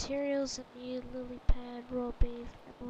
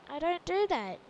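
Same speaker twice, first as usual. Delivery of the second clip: playful protest, somewhat louder; mock protest; 3 semitones higher, 5 dB louder than usual. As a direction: object firmly, still fairly contained. Protests the stereotype, first take.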